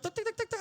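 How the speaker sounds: high rapid mechanical ticks; ticking noise. Retold or imitated. Woman trying to calibrate a crazy lie, high ticking. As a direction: tick like a machine computing.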